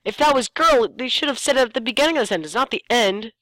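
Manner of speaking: high, fast exasperated rant; exasperated complaint. Real, not acted. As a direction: vent about the sentence's bad order. Complaining about the sentence.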